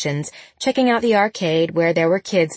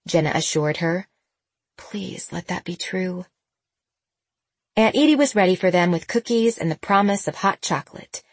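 ordinary delivery, nothing marked

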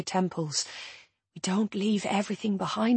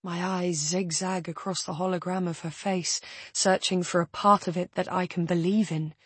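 usual speaking voice

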